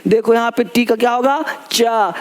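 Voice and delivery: usual speaking voice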